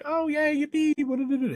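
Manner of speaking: squeaky high sarcastic cheer, far above his voice; high mocking yay. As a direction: chirp it like a fake-thrilled cartoon. Mocking fake client enthusiasm.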